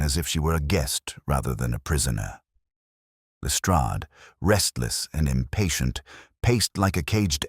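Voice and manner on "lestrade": ordinary delivery, nothing marked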